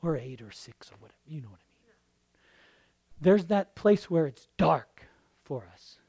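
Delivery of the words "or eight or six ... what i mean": quick, soft whisper; whispered joke; whispered aside. Real, not acted. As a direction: toss off a hushed aside.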